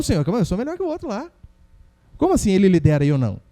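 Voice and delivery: indignant, proud protest to God; arrogant complaint. Acted. Adonias protesting to God that he is better, arrogant. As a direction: puff up and demand why not you. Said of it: "Adonias se achava melhor que seu irmão".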